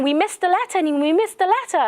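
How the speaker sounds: high, crying lament; wailing monk. Retold or imitated. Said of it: "and he's crying".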